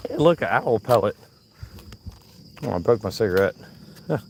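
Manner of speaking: normal talking voice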